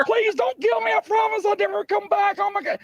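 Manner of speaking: very high, shrieking mock panic; terrified pleading. Panhandler begging the officer not to kill him, shrieking. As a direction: squeal it like you think you're about to die.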